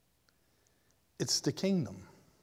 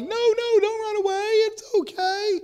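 much higher pleading voice, a bit louder; pleading with a dog; 5 dB louder than usual. Acted. You calling after a wild dog running away, high pleading. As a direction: call after it in a high pleading voice, don't scare it. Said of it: "and you're like".

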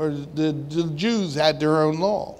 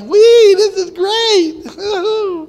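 squealing high, slow fake glee; sarcastic high-pitched whee; mock cheerful; 12 semitones higher, 8 dB louder, much slower than usual. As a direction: squeal it like a happy kid. Ironic cheerful Jesus, laughter follows.

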